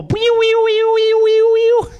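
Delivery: shrill squeaky ray noise; high ray-gun whee. Pretend. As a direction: mimic a zapping ray gun.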